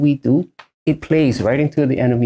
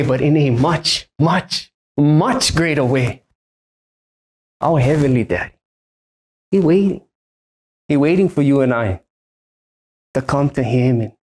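normal talking voice

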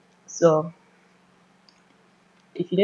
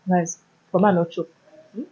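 chewing while talking; muffled by food; mouth full. Real, not acted. Seen 2: Holds wrapped food, lips pursed, apparently chewing while speaking.

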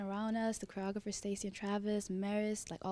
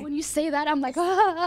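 excited; 7 semitones higher, 10 dB louder than usual. Real, not acted. Flustered by praise.

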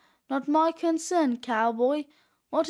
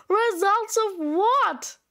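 high, drawn-out exasperated question; raised-pitch teacher outburst; exasperated demand; 5 semitones higher, slower than usual. Acted. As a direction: demand it slowly, at the end of your patience. Exasperated teacher questions evasive student.

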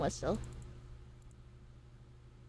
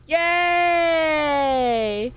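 excited yay; 5 semitones higher than usual. Real, not acted. Streamer cheering back at chat after laundry, excited shout. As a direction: cheer like you're glad to be back.

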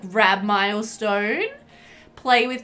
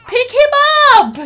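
shrieking in frustration; 8 dB louder than usual. Real, not acted. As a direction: screech at the game in disbelief. Sim still ignoring baby.

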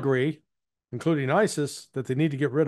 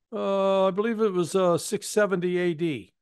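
higher, hesitant, matter-of-fact; imitating Iranian asset; 7 semitones higher than usual. Iranian asset answering the CIA handler, higher hesitant deadpan. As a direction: drop the punchline with innocent hesitation. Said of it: "the guy stopped and goes".

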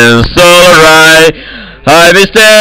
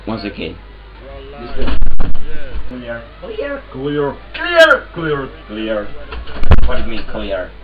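much softer, near-whispered tactical calls; hushed game callouts; 5 semitones lower, 6 dB quieter than usual. Real, not acted. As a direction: keep it low so nobody hears you. Sneaking, calls out clear.

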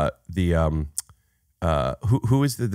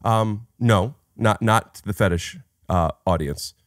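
normal talking voice

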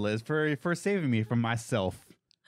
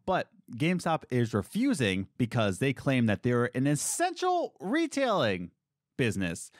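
ordinary delivery

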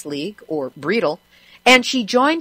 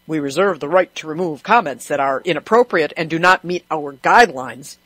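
lower, officious disclaimer voice; mock official; 3 semitones lower than usual. Host quoting the agency's comment rules, mock official. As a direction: recite it like an official notice.

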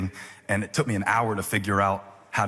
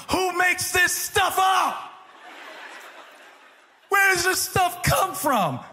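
exasperated high shout; slower than usual. Acted.

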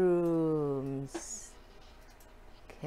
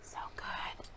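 whisper; 15 dB quieter than usual. Real, not acted. Whispered savoring of food.

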